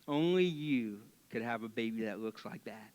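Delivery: low and soft, a deadpan polite dodge; a pastor quietly dodging a lie about a baby; sly compliment. Shown as a demonstration. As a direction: slip it in quietly, keeping a straight face. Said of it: "That's how you do it".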